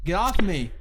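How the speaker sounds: loud, high "get off me"; sudden cry for release; shouted protest. Acted. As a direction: shove them away with your voice. Scuffle in the story.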